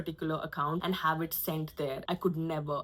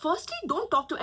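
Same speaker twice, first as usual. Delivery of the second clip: higher, affronted protest; mock indignant aunt; 9 semitones higher than usual. Aunt starts scolding.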